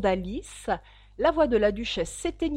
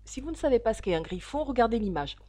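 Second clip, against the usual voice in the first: ordinary delivery, nothing marked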